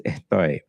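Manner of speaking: Estoy with the S lisped, almost a TH. Retold or imitated. Teacher imitates the student's lisped Estoy. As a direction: lisp the S in Estoy. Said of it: "it's almost like a lisp".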